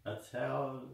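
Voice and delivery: ordinary talk, nothing marked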